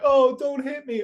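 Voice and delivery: high, drawn-out mock plea; acting stupid. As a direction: play dumb, whining high and slow. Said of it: "like, really act stupid".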